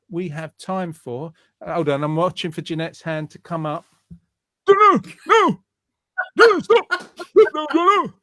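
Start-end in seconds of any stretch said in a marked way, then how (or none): 4.7-8.1 very high, loud mock protest; hammed-up high-pitched squawk imitating a woman; Jeanette impression. Host mimicking co-host to get her back for muting, hammed-up impression. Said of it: "Is that what I sound like?".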